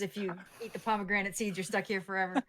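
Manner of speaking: ordinary talk, nothing marked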